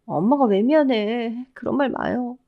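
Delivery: slow, plaintive daughter; sad, drawn-out reassurance; sorrowful consoling. A narrator's reading. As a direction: gently protest a mother's apology through grief. Bereaved daughter consoles weeping mother.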